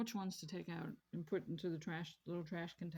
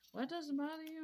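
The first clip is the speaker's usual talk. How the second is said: surprised question; 9 semitones higher than usual. Retold or imitated. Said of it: "they're like".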